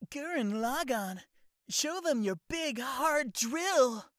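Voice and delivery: high, loud heroic shout; hot-blooded anime hero. Retold or imitated. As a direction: roar it like a hero. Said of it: "anime impression video".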